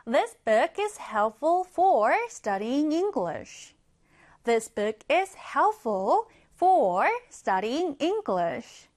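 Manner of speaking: very slow, clear English sentence; slow teacher's reading; slow example read. Shown as a demonstration. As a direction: read it word by word for learners. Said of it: "하나 더 보겠습니다".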